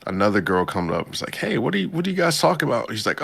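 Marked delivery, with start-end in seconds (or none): 1.3-2.9 put-on high, fast bubbly girl's question; girly voice. A girl barging into the chat, high and quick. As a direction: pop in like a nosy schoolgirl.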